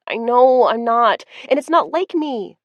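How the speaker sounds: frustrated growl. Acted. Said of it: "Misty growled on the other end in frustration".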